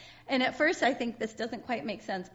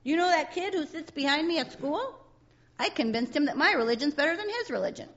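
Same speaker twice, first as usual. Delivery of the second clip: voicing Sally; 6 semitones higher, 5 dB louder than usual. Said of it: "Sally talking to Linus".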